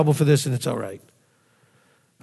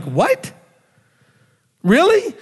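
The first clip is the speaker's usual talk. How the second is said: mock shock; 6 semitones higher, much slower than usual. Shocked followers rejected by Lord.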